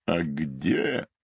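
slow theatrical rhinoceros with swooping pitch; lamenting rhinoceros. Acted. Rhinoceros searches for the tracks of his life, lamenting. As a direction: lament it, stretching the key words.

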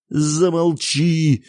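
sharp, loud, drawn-out shout; furious father shouting at his wife. A narrator's reading. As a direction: yell it at her to shut up. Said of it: "закричал отец на мать".

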